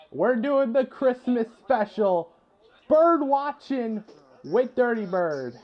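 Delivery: high, slow show-intro announcement; announcer voice. Acted. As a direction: announce the segment title grandly. Introduces the bird watching segment.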